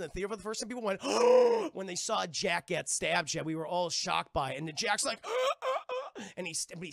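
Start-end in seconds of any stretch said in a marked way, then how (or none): 1.0-1.7 loud, high shocked gasp; imitated audience gasp. Re-enacting the theater crowd as Jack is stabbed, shocked gasp. 5.2-6.2 high, mock-pained grunts; imitated stabbed grunts. Acting out Jack getting stabbed, mock grunts.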